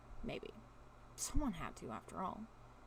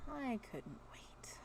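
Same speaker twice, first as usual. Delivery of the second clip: whisper; 4 semitones higher, 6 dB quieter, much slower than usual. A narrator's reading. Narrator whispers inner thought.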